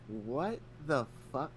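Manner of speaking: slow, stretched-out disbelief; disbelieving. Retold or imitated. Reading a ChatGPT question, slow stunned disbelief. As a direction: drag it out in disgust. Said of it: "And I'm like".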